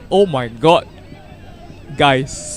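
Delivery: ordinary delivery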